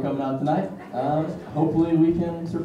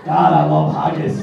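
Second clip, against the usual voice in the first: mock Scottish accent; 5 semitones higher, 6 dB louder, slower than usual.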